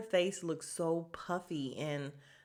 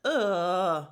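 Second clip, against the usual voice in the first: exasperated groan; 7 dB louder, much slower than usual. Retold or imitated. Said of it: "And I was just like".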